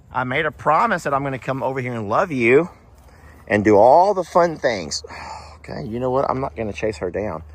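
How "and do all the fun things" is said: usual speaking voice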